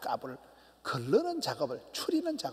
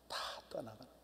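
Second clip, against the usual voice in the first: whispered and much softer, fading out; 4 semitones lower, 12 dB quieter, much faster than usual. Real, not acted. Preacher telling the congregation all people leave, whispered. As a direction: let it die away to a breath. Preacher lets the line fade.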